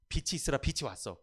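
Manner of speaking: ordinary talk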